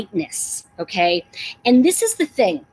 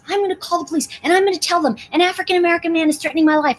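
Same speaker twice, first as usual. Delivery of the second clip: fast, higher mock-frantic voice; mock panicked woman; 6 semitones higher, much faster than usual. Mocking the woman threatening to call police, fast mock-frantic. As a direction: rattle it off like a panicked caller, mocking her. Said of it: "She goes".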